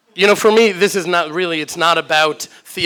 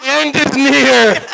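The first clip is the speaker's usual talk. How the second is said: mock doom shout; 8 semitones higher, 5 dB louder, much slower than usual.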